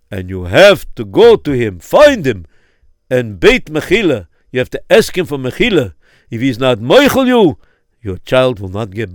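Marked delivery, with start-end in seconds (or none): none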